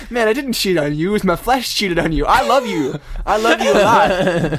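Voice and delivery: high, loud protest of innocence; mock pleading. Imitating a man excusing cheating on his partner, mock pleading. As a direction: plead your case like a hammed-up cheater.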